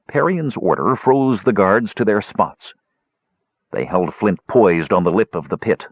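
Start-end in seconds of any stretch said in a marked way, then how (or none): none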